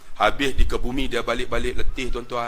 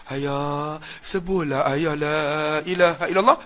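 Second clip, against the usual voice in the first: sorrowful plea; slower than usual. Retold or imitated. Son whispering the creed to his father, sorrowful. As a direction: coax him tenderly with sorrow. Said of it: "dia buat nada sayu".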